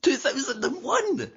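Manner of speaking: can't believe how long ago; disbelief. Real, not acted. Hearing their last away win was 2001, stunned disbelief.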